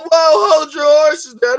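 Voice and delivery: drawn-out high call to stop; slow, loud, rein the cohost in; hold your horses. Real, not acted. Host cutting in on his cohost, stretched loud call.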